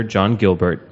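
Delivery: ordinary delivery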